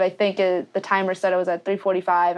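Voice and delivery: usual speaking voice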